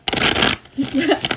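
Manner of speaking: loud high giggle; bright burst of laughter. Real, not acted. Reader cracking up at her own joke, loud giggle.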